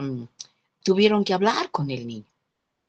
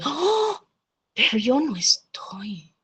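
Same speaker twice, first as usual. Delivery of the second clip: gasped high, then slow whisper; shocked father; 5 semitones higher, slower than usual. Retold or imitated. Father seeing he is missing from the drawing, shocked. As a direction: imitate a dad's stunned, hushed dismay.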